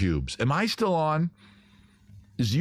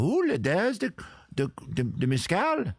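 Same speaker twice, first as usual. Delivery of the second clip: light mock Dutch accent, pitched high; a hint of a Dutch-accented gnome; mock Dutch accent for a sneaky gnome; 7 semitones higher than usual. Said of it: "I'm assuming they would sound, um, Dutch".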